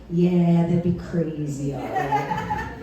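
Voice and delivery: low, drawn-out mock agreement; sarcastic agreement. Comic echoing a date's "that'd be crazy", sarcastic agreement. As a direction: echo it slowly and low, half laughing. Her sarcastic echo of his reply.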